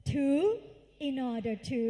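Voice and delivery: loud drill, pausing between phrases; loud group recitation. Shown as a demonstration. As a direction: call it out loudly for the class to echo. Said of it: "ดังๆ ค่ะลูก สาม สี่ เอ้า".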